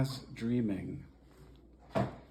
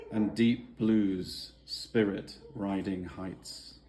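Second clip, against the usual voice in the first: measured, unhurried poetry recital; reading a poem aloud to family at the table; 2 semitones lower, 6 dB louder, slower than usual. Acted. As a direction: savour each image as you read. Reads own poem aloud.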